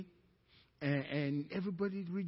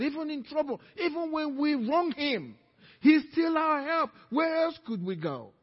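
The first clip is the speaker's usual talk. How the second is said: raised, emphatic exhortation climbing in pitch; fervent preaching prayer; 6 semitones higher, 7 dB louder than usual. Real, not acted. Preacher praying for the congregation to stay willing, fervent exhortation. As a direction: build from prayer into a high, forceful appeal. Prays and exhorts at full force.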